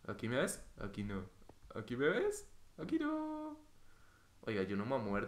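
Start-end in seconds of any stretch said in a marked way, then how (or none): 1.8-3.6 high-pitched teasing peekaboo; burla juguetona. Pretend. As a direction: repeat it as a silly squeaky taunt. Peekaboo game, teasing repeat. (burla juguetona con voz aguda)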